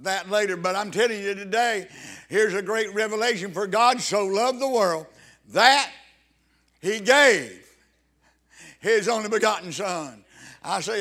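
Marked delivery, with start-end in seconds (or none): 3.5-10.2 louder, soaring preacher's cry on "gave"; fervent preaching. Acted. Preacher proclaiming John 3:16 to the church, louder and fervent. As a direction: preach it out with rising fire.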